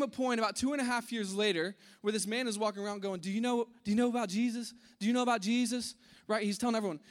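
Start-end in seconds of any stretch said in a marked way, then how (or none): 3.2-6.0 eager spreading the word. Retold or imitated. Man spreading news eagerly.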